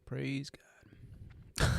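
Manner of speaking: whispered, barely voiced. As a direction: breathe the words out in a hush. Repeated as breathy whisper.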